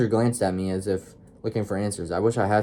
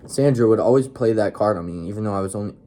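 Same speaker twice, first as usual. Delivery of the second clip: ordinary delivery, nothing marked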